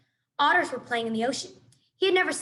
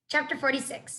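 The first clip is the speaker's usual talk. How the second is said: usual speaking voice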